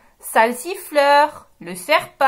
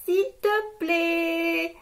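slow, soft and sweet please; 4 semitones higher, 5 dB quieter, much slower than usual. Shown as a demonstration. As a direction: model asking nicely for kids. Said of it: "en disant". (s'il te plaît tout doux et lent)

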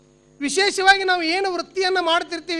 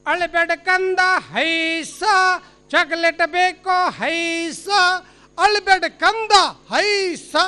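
lineman's heave-ho lullaby; 3 semitones higher, 4 dB louder, much slower than usual. Acted. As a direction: soothe a child as if hauling a pole. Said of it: "ನೆನಪಾಗಿಬಿಡ್ತು".

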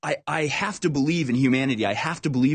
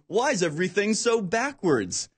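higher-pitched, put-on baffled voice; mock bewildered complaint; 7 semitones higher than usual. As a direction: play someone cluelessly lamenting, pitched up. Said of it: "on the other hand going".

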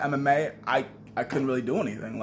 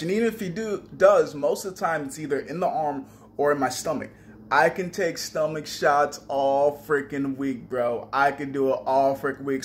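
usual speaking voice

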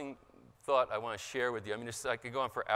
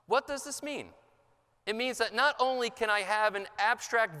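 louder, higher, declaiming read to the room; formal speech reading; 7 semitones higher, 6 dB louder than usual. Acted. Speaker reads his written piece to the conference, rousing and declaimed. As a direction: deliver it like a rousing written address.

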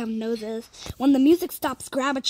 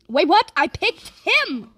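incredulous; 5 semitones higher, 5 dB louder than usual. Real, not acted. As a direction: blurt it out in disbelief. Shocked at the result of choice.

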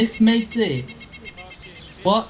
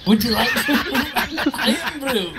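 mock Scottish; 5 semitones higher, faster than usual. Mock Scottish offer of Irn-Bru.

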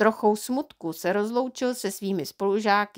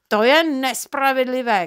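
put-on a loud, indignant child's cry, a bit higher; child's shout; 3 semitones higher, 5 dB louder than usual. A narrator's reading. Boy shouting at his father that it's unfair. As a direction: protest it loudly as a wronged boy. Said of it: "křičel jsem".

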